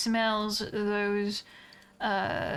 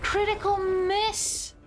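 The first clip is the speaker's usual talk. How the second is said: excited; 10 semitones higher, slower than usual. Real, not acted. Reacting to a dice roll.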